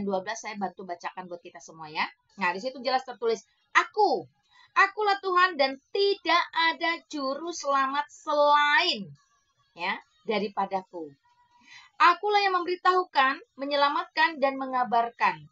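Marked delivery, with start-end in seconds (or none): 3.8-15.5 louder, higher proclamation of God's words; preaching scripture. Acted. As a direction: declaim the verse like a pulpit reading. (membacakan ayat dengan lantang seperti berkhotbah)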